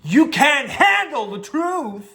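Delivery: hammed-up slow furious courtroom roar; Jack Nicholson impression. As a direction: hurl it at the witness stand, drawn out. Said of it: "Jack Nicholson".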